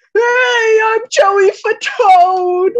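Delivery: high, loud, drawn-out shouted intro. Acted. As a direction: belt it out slowly like a big entrance. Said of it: "Joey Fatone cries in the distance".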